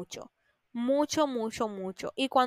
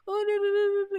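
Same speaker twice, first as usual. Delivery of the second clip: higher and louder mock crying protest; mock wailing; 8 semitones higher, 5 dB louder than usual. Mocking someone crying to a friend, mock wail. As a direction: ham up a tearful no.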